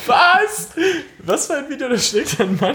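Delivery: high-pitched shocked disbelief. Real, not acted. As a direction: squeak out a stunned what.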